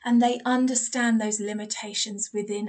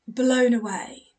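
ordinary delivery

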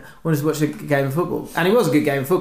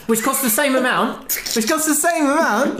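higher and higher, disbelieving repeat; exasperated complaint; 10 semitones higher than usual. Retold or imitated. Telling the bar woman he was overcharged, climbing exasperation. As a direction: protest it, pitch climbing in outrage.

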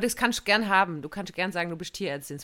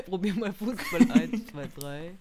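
laughing tease; slower than usual. Pretend. Teasing mimic of the story.

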